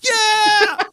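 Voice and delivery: high, shouted frat-buddy cheering; cheering buddies. Retold or imitated. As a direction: hype him up like rowdy friends. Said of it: "they're like".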